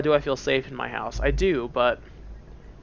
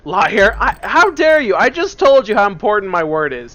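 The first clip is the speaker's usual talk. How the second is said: loud, higher angry game voice; furious accusation; 7 semitones higher, 10 dB louder than usual. Acted. Game voice catches the player lying, furious shout.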